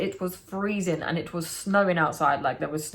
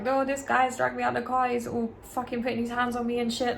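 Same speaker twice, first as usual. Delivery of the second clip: imitating crying; 5 semitones higher than usual.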